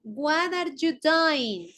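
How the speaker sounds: "What are you doing?" drawn out slowly; slow, deliberate model of "What are you doing?"; slow English model. Shown as a demonstration. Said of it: "en el anterior era".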